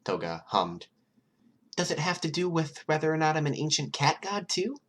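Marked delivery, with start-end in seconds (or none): 1.7-4.8 put-on high, playful girl's voice; Toga's voice. A narrator's reading. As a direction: pitch her up high and teasing. Toga's voice in the read story.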